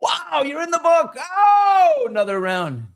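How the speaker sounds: high loud barroom cheer; joking cheer. Acted. Friends celebrating his record in the bar, loud cheer. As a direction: cheer like a drunk pal. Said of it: "He goes".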